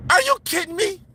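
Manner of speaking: ordinary talk, nothing marked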